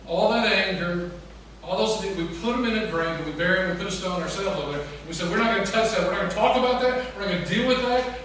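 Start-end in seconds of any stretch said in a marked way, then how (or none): 5.3-8.2 loud, raised, insistent list; emphatic refusal. Acted. People burying their failures, refusing to face them, loud and insistent. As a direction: hammer each refusal in parallel beats.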